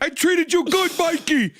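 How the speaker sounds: high, strained comic cry; hammed-up high-pitched pleading; mock movie plea. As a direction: wail it like a bad horror victim. Mimics pleading line from horror remake.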